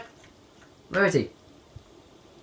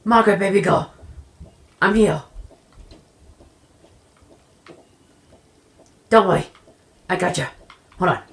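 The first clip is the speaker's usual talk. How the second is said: calling out loud; 2 semitones higher, 9 dB louder, faster than usual. Acted. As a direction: shout to her as if rescuing her. Calling out to trapped Margaret.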